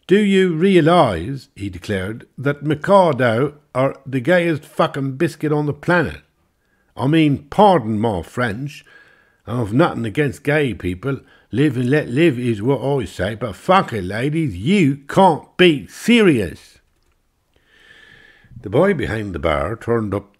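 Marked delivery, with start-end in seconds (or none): 0.1-1.5 slow, high, loud pronouncement; loud declaiming. Acted. Englishman declaims. 2.4-16.8 high, loud barroom bluster; blustering rant. Acted. Englishman rants to ladies.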